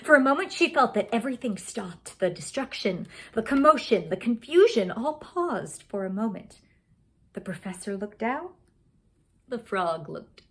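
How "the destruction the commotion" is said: usual speaking voice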